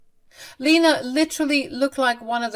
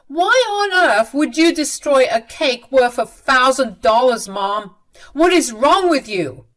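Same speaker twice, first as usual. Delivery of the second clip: angry yell; 6 semitones higher, 8 dB louder than usual. Acted. Son yells at mother in quarrel.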